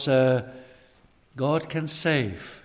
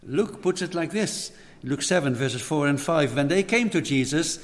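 normal talking voice

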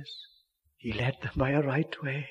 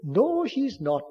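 higher, slow mock disbelief; doubtful father; 7 semitones higher, 3 dB louder, slower than usual. Father doubting mother's ears, slow mock disbelief. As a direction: drawl it doubtfully.

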